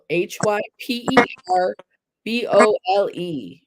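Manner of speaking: normal talking voice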